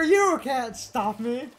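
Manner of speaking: ordinary talk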